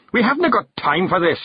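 high, impatient Scottish snap; Rob Anybody snapping. A narrator's reading. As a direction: cut the chatter short, mildly cross. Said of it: "snapped Rob Anybody".

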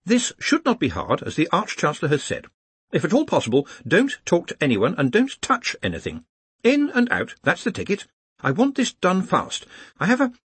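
high-pitched, careful briefing; brief the group in a high, anxious-to-be-orderly voice; high academic giving instructions; earnest organiser. Acted. Ponder briefs the wizards.

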